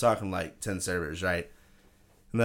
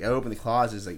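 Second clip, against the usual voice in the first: usual speaking voice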